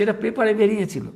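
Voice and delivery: ordinary talk, nothing marked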